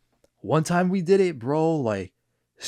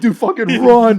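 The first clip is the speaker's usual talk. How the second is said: panicked laughing yell; 11 semitones higher, 12 dB louder than usual. Retold or imitated. Fleeing, warning friend.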